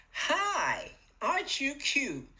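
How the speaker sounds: high, bright-voiced cooing hello; cheerful greeting. Cheerful hello to yourself, contrasted with the groaning morning. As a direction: greet yourself sweetly, lifted up. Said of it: "Can you hear the difference?".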